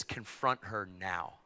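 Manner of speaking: low, soft, slow command; quiet, weighty divine instruction; the Lord's voice. Retold or imitated. The Lord speaking to preacher in bathroom, low command. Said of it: "the Lord spoke to me".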